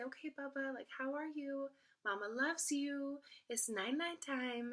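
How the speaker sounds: gentle sing-song check-in from mama; calm, soothing. Retold or imitated. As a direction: soothe a baby in a high, slow lilt. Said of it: "just use the calm, soothing tone".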